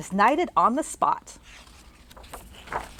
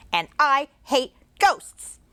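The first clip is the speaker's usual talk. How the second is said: put-on higher, slow sulky child voice; grumpy boy; 5 semitones higher, slower than usual. Little boy in the bathroom sulking. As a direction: pout it out like a cross little boy. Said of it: "said the boy".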